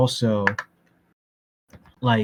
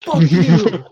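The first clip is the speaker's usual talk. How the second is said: outraged cursing; 7 dB louder than usual. Real, not acted. Mock-angry rant over a film delay.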